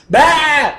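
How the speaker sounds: a jolting loud bark to break the pattern; loud "Bah!". Shown as a demonstration. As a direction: burst out a startling noise. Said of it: "I make that noise".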